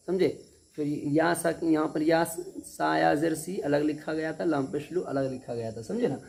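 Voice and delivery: ordinary talk, nothing marked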